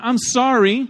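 high, loud, insincere apology; sorry with attitude. Acted. Pastor shows the wrong apology, high with attitude. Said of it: "You can say sorry with an attitude".

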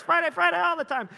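high, loud sing-song cheer; playful cheer. Retold or imitated. Dad cheering his son that every day is Friday, bright and playful. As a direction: pump the kid up, playfully.

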